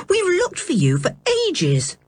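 a hint of a curious lad; curious boy. A narrator's reading.

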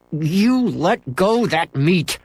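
high, slow stubborn order; angry demand. A narrator's reading. Boy tugging meat from dog, angry and slow. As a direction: insist the dog let go.

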